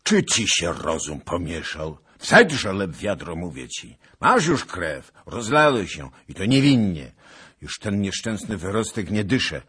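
loud angry rebuke; scolding shout. Acted. Zagloba scolding the raging Bohun, loud. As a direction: bellow at him to calm down. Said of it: "Tak krzycząc".

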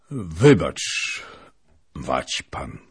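put-on loud cold old princess voice; dry, sharp refusal. Princess refuses the lieutenant, dry and sharp. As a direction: cut him off coldly and sharply. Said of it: "głos jej stał się suchy i ostry".

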